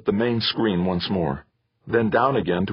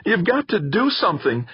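high-pitched urgent shout; 13 semitones higher than usual. A narrator's reading. Son begging his father to act, shouted. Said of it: "Theo shouted".

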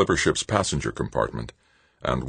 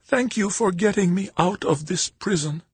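put-on grateful old woman. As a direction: thank him, near tears. Tearful old woman thanks.

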